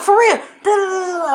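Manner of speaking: high, loud mock rant; freaking-out homegirl. Homegirl freaking out at her calm friend, hammed-up rant. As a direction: mimic her going off, trailing into nonsense syllables.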